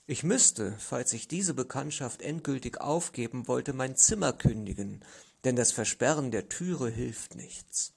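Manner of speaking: usual speaking voice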